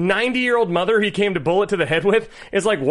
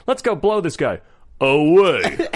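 Stallone voice; slower than usual. Retold or imitated. Quoting Demolition Man, lightly voiced Stallone. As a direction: growl the action line like Stallone. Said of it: "your Stallone".

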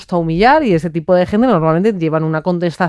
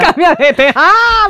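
mock panic; 8 semitones higher, 3 dB louder, slower than usual. Mock panic, changing topic.

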